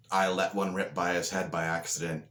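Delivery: usual speaking voice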